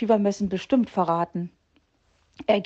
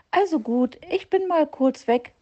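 high chirpy bird voice, giving in; little bird giving in; 7 semitones higher than usual. A narrator's reading. Little bird tells the fox he'll be gone briefly, high and chirpy. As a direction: agree lightly in a piping voice. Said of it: "sagte er".

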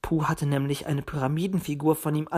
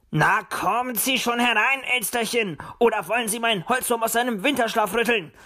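higher, merry call to someone outside; cheerful calling; 6 semitones higher than usual. A narrator's reading. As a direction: call out merrily towards the door. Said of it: "rief er fröhlich". (fröhlich rufend)

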